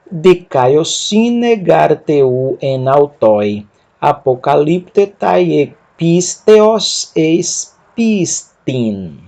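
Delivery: each syllable drawn out very slowly; slow Greek demo. Shown as a demonstration. Teacher models Greek for students, very slow. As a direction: spell the word out slowly for learners. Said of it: "Lendo tudo até aqui".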